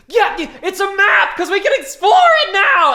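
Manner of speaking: high, loud excited scream. Retold or imitated. Retelling his scream at Joey before the chest opens, high and loud. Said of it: "I screamed".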